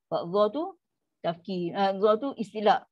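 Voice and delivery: normal talking voice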